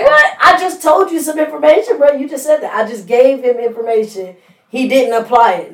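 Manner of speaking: disbelieving what they hear; exasperated. Retold or imitated. Imitating a frustrated mentor.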